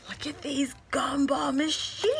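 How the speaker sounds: breathless whispered wonder; awed whisper. Real, not acted. Vlogger marveling at the gumball shakers, awed whisper. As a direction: whisper it like a precious secret.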